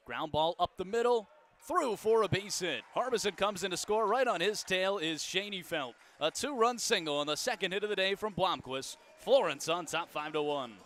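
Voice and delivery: loud, high rising home run call; excited play-by-play. Real, not acted. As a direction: shout the big hit to the crowd. Big two-run hit called.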